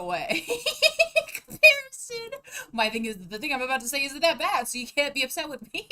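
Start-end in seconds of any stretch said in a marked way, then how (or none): 0.5-2.3 laughing it out, high and loud; words squeezed through laughter; real laughing fit. Real, not acted.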